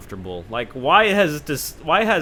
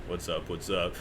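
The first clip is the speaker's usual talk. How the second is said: catchphrase; 6 semitones lower, 8 dB quieter than usual. Pretend. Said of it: "do the mic and go".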